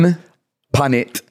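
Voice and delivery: "Tan", pause, then "pan it"; slowed down for learners. Shown as a demonstration. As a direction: break the phrase up for learners. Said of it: "Can you slow that down a little bit?".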